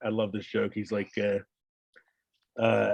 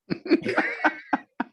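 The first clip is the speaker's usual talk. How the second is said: very high breathless laugh. Real, not acted. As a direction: crack up at the punchline. Laughing at punchline.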